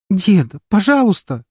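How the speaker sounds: high, loud pleading wail; wailing plea. A narrator's reading. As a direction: wail at grandpa, begging. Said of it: "продолжала причитать".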